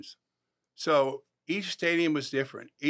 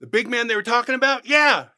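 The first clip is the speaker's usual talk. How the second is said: loud questions and high shouted yeahs; mounting excitement; 7 semitones higher, 10 dB louder than usual. Retold or imitated. Asking the player about his favorite movie, mounting excitement. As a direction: build it to a shouted payoff.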